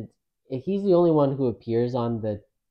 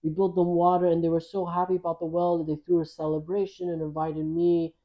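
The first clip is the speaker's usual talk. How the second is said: mock Australian accent, higher pitch; philanthropy host impression; 4 semitones higher than usual. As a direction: play the Australian host reporting good deeds. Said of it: "he's, like, Australian or".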